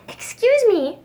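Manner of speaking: high little cricket voice; a tiny cricket, speaking up; small cricket voice. Acted. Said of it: "coughed a cricket".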